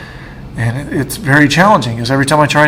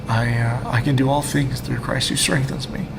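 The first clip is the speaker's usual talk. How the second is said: quiet; 8 dB quieter than usual. Real, not acted. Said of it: "I'm emotional".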